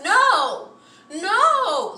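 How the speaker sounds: high, loud, drawn-out protest; exasperated shout. Real, not acted. Driver ranting about badly parked cars, loud and exasperated. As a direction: wail each refusal at the bad parkers.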